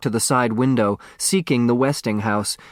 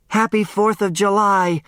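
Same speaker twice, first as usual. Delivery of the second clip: shouted; 9 semitones higher than usual. Acted. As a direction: call it out over the fireworks. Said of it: "Turtle shouted".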